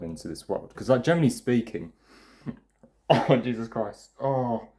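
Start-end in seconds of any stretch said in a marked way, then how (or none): 2.5-4.7 laughing after a burp. Real, not acted. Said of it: "I just burped with lemonade".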